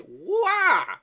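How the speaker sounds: high, loud fake baby cry; mock whiny wail. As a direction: wail like a mocked crybaby. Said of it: "whiny little bitches going".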